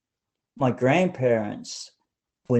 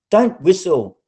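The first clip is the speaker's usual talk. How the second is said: a sharp, louder warning from the cousin; scolding cousin; 6 semitones higher, 9 dB louder than usual. A narrator's reading. Cousin scolds narrator through the window, sharp and loud. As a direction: cut the whistling short, higher and louder.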